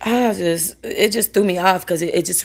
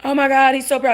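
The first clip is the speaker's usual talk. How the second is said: higher, louder mock adoration; gushing admirer; 8 semitones higher, 5 dB louder, faster than usual. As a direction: imitate a stranger fussing over a puppy. Imitating admirers of her dog.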